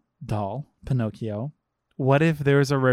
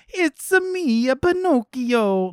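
high, slow cartoon Italian accent, Mario style; hammed-up Italian accent; 11 semitones higher, 3 dB louder, slower than usual. Showing the film how to commit to Italian accents, hammed-up Italian. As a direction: overdo a squeaky Italian accent, like a video game plumber. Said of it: "just to your point of accent work".

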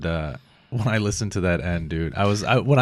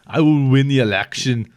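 attempted Austrian-accent impression; off-target mock Austrian accent; Arnold; 5 dB louder than usual. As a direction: declare it like a loud Austrian politician. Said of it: "that didn't sound like Arnold".

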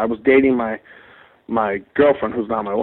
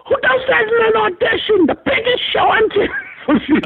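much higher, shocked scolding wife voice. Retold or imitated. Wife berating him over the audition, very high and shocked. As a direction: shriek it like an appalled wife.

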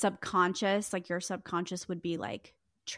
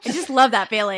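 mock-delighted, laughing through it; louder, higher, joking along with a laugh; laughing sarcasm; 4 semitones higher, 12 dB louder than usual. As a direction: play along with the delusion, giggling. Joking along with the delusional act.

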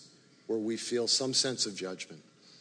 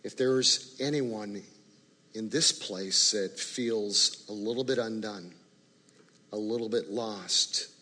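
closing prayer; 3 semitones lower than usual. Acted. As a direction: pray gently over the room.

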